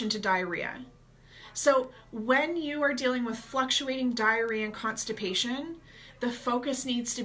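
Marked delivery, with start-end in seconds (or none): none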